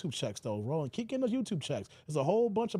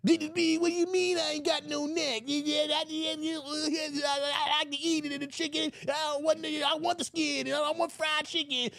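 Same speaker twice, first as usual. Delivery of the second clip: high squeaky shouting rant; Roland Martin impression; 8 semitones higher than usual. Roland insisting Republicans won't help, high shout. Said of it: "he ain't got a neck".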